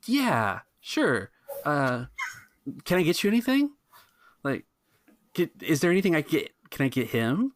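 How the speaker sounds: put-on high, eager young waiter.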